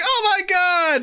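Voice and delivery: high, drawn-out mock panic; panicked passenger. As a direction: act like a terrified hostage. Acts out terrified bus passengers.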